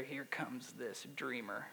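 slow, low, softly snarky; a little snarky. Acted. Brothers sneer to one another as Joseph approaches, quietly snarky. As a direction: mutter it slowly with quiet scorn. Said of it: "read it with a little snarky voice".

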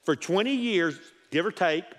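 ordinary talk

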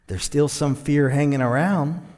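usual speaking voice

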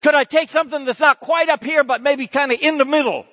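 high, loud pleading; pleading question. Acted. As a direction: beg for a plan B. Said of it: "the temptation Jesus always faced".

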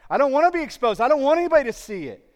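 loud, high pleading cry as Paul. Acted. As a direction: cry out like a begging man. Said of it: "Paul's like".

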